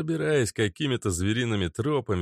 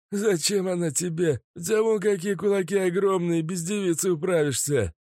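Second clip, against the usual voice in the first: mocking thug voice; 11 semitones higher than usual. Mazut mocks the guard.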